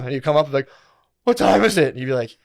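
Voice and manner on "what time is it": eager question. Retold or imitated. Asking brother under blankets.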